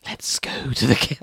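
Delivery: breathy voiceless hiss; attempted hiss. Shown as a demonstration. Reader trying to hiss Hannibal's line, mostly air. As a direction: push the words out on air like a snake. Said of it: "I can't, I can't hiss it".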